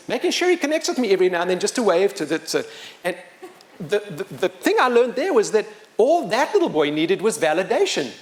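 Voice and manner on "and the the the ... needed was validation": usual speaking voice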